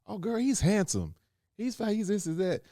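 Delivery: put-on high, excited girlfriend gushing; mock gushing woman. A woman gushing to a friend about a man in a suit, high excited voice. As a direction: squeal it like a girl admiring a man. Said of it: "It's like, especially if a man is".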